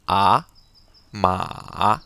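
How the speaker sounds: «ma» qui descend puis remonte; third-tone ma, falling then rising; troisième ton chinois. Shown as a demonstration. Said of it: "d'abord descend ma, après monte". (troisième ton descendant-montant)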